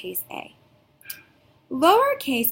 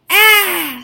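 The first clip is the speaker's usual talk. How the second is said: mock monster scare, loud high "Ah!"; scared gasp; 7 semitones higher, 10 dB louder than usual. Shown as a demonstration. Teacher demonstrating scared letter A gasp. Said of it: "letter A says".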